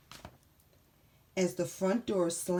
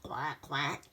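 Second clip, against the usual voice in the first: duck quack; 5 semitones lower, 5 dB quieter than usual. Acted. Duck sound effect.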